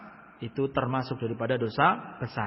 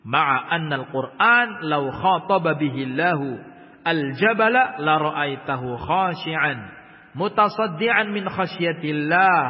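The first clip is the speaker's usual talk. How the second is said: raised, formal Arabic reading voice; recited Arabic from the book; Arabic text recitation; 6 dB louder than usual. Acted. Reads Arabic kitab text.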